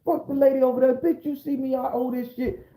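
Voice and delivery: high-pitched acted tirade; laying into everybody. Defendant cursing out the whole courtroom, acted rage. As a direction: rattle off curses with the voice way up. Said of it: "I'm laying into everybody in the courtroom".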